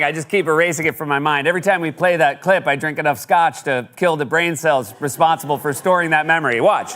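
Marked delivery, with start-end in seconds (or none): none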